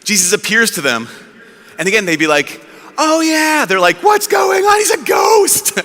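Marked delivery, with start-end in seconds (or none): 3.0-3.7 high, breezy remembering; casual realisation. Retold or imitated. Apostles imagined greeting the risen Jesus, casually remembering. 4.0-5.8 very high, frightened comic shout; mock terror. Locked-in apostles see Jesus appear, mock terror. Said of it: "for fear".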